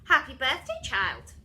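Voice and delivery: loud, clipped mock greeting; stern teacher. Miss Seeth greeting birthday child, stern and loud. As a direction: bark the birthday wish like a tyrant.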